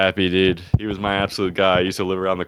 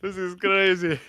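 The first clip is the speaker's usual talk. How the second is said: mock Indian accent, much higher; hammed-up Indian customer panicking about a slug; imitating an Indian neighbor, high and playful; 10 semitones higher than usual. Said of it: "I feel like Indian accent is".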